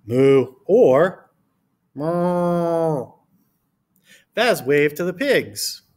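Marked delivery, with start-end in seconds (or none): 0.1-0.6 loud, short spoken moo; playful moo for children; cow noise. Shown as a demonstration. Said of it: "you can make a cow noise". 1.9-3.1 long, drawn-out cow moo; sustained put-on moo; cow noise. Shown as a demonstration. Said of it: "you can make a cow noise".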